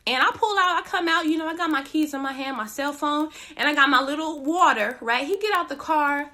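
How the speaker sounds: ordinary talk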